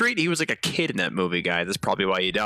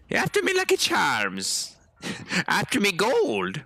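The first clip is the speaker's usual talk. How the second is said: higher, slow hammed-up Irish accent; mock Irish leprechaun; 6 semitones higher, slower than usual. Streamer joking that the game characters sound Irish, doing a leprechaun. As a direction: play a cartoon leprechaun guarding his gold. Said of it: "Why is everybody all, like, Irish?".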